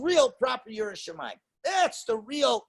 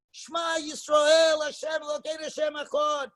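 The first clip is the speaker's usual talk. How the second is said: out loud; 5 semitones higher than usual. Shown as a demonstration. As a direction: declare it aloud to the room. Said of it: "we say it out loud".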